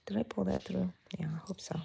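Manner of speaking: whispered aside to herself. Real, not acted. Crocheter checking her stitch, whispered to herself. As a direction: breathe it under your breath, Texas crafter.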